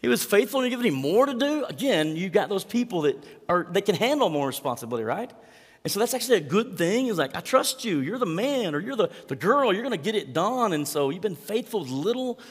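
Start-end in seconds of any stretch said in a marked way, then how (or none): 0.0-1.6 much higher, louder disbelief; incredulous surprise. Retold or imitated. Reader objects to the parable, mock incredulous. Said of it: "some of you read that and go". 7.4-12.4 higher, upbeat encouragement; encouraging master. Acted. Master encourages trusted servant, lightly excited.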